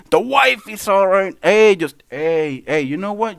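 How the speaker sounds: ordinary talk, nothing marked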